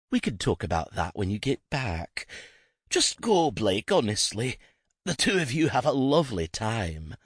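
a hint of a sly invalid shooing them away; lightly voiced theatrical old lady. Narrator voices Jacqueline.

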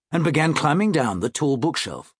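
ordinary delivery

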